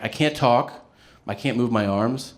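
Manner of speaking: ordinary talk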